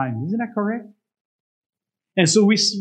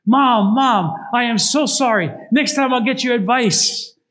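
high, loud pleading son; a son's loud tearful apology; 7 semitones higher, 5 dB louder than usual. Acted. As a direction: beg his mother's forgiveness, voice up high. Son scrubbed, sobbing apology.

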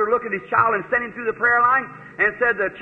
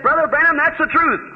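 excited confirmation; 8 semitones higher, 4 dB louder than usual. Retold or imitated. Girl confirms the prophecy, amazed.

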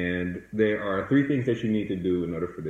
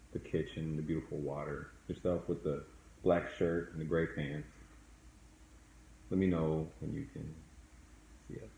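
soft spa whisper; 2 semitones lower, 5 dB quieter than usual. Acted. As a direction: lead them calmly into the past. Leads a guided meditation.

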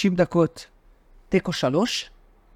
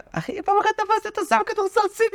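put-on high squeaky voice, teasing; teasing a friend in a squeaky put-on voice; mock high voice.

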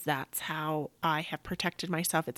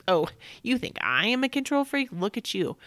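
higher and louder mock retort; retold angry comeback; 5 semitones higher, 5 dB louder than usual. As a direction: throw it back at him, hammed-up fury. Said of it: "I want to yell and cuss".

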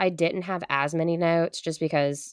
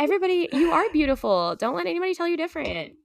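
1 high mock pep talk; 12 semitones higher, faster than usual. Addresses listeners with encouragement.

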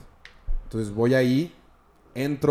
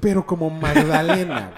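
slow, laughing jab; laughing tease; 4 semitones higher, 6 dB louder, slower than usual. Real, not acted. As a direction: drag it out through laughter. Teasing about crying.

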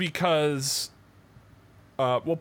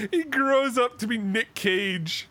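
laughing through words; 9 semitones higher, slower than usual. Real, not acted. Still laughing while speaking.

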